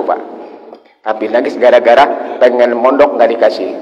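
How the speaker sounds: ordinary talk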